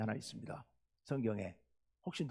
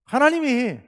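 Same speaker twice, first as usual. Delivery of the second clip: loud, indignant protest to God; Jonah's angry outburst; 4 semitones higher, 9 dB louder than usual. Retold or imitated. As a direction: act out an exasperated, raised-voice complaint. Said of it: "성질을 있는 대로 내면서".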